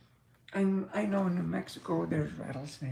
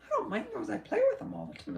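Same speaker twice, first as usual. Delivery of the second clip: cowgirl lady voice; 13 semitones higher, faster than usual. Retold or imitated. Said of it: "She says".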